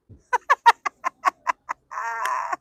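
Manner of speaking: loud, amused burst of laughter; real laugh at her own story; hearty laugh. Real, not acted.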